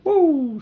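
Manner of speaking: high breath out of relief; relieved woo. A narrator's reading. Polly breathes out in relief, high woo. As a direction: let the tension go. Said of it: "long breath of relief".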